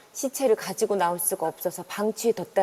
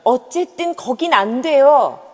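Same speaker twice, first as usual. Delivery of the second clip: louder, insistent no; firm refusal; 5 semitones higher, 11 dB louder than usual. Acted. 단호하게 크게. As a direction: innkeeper cutting off the guests, firm.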